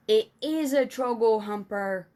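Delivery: loud, exasperated giant insisting. A narrator's reading. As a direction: insist on it, out of patience. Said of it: "cried the exasperated B F G".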